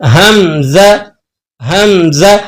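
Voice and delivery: हमज़ा spoken very slowly, हा unstretched; slow model of हमज़ा, हा and मीम joined; correct hamza, slowly. Shown as a demonstration. Teacher modelling the right reading again. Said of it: "आपने इसको इस तरह से पढ़ना है".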